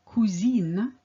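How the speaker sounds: cousine ending in a clear "een"; French "een" ending. Shown as a demonstration. Teacher showing learners the feminine form, clear "een" ending. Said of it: "it ends in the sound in".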